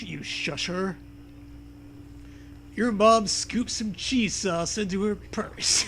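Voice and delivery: ordinary delivery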